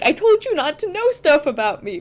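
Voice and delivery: exasperated partner. Retold or imitated. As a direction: play an exasperated partner telling off their boyfriend. Partner's complaint acted out.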